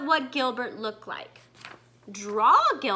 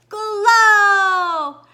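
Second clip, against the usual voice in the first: higher, much louder burst; loud exclamation; 7 semitones higher, 10 dB louder than usual. Acted. The anglerfish lighting the cave, loud bright call. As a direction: announce the light like a magic word.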